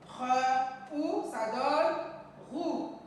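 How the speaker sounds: "Re", pause, "ou", then join them as "roue"; syllable blending. Shown as a demonstration. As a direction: sound out "Re" and "ou" separately before "roue". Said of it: "Nous allons lire nos syllabes formées". (détacher « Re » et « ou », puis lire « roue »)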